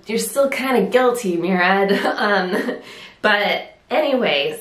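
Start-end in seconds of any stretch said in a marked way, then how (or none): none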